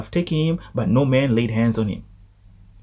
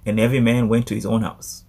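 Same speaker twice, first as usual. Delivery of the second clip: ordinary talk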